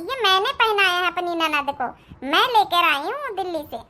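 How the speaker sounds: normal talking voice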